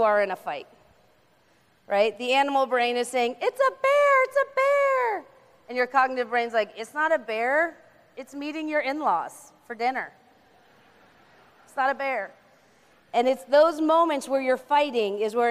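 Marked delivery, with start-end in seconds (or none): 3.4-5.2 much higher, squealing mock panic; panicked alarm. The animal brain warning of danger, in high mock panic. 6.7-12.3 level, matter-of-fact reassurance; calm reassurance. Acted. The thinking brain calming the animal brain, steady and reassuring.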